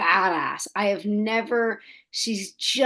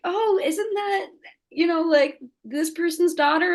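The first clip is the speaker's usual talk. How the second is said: mom's surprised question; 8 semitones higher than usual. Retold or imitated. Daughter quoting mom asking about a daughter, mock mom voice.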